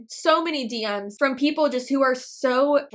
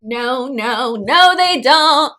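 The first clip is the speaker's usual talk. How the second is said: emphatic denial; 10 dB louder, much slower than usual. Real, not acted. Vlogger cutting off a millionaire claim, loud denial. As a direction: start firm, then holler the contradiction, stretched out. Loudly contradicting the played clip.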